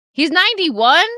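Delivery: loud, high disbelieving question; astonished. Real, not acted. Hearing the actor's age from chat, loud astonishment. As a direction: gasp it out in shock.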